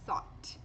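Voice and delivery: "Thought" whispered, tongue between teeth on th; whispered model. Shown as a demonstration. Teacher whispers "Thought" with the th tongue position. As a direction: breathe "Thought" with the tongue tip caught. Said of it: "com a língua um pouquinho presa".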